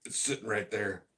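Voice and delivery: talking around a held-back burp; holding a burp. Real, not acted. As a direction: burp stuck in the throat. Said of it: "God, I got a burp".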